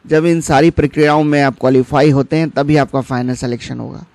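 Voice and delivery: ordinary talk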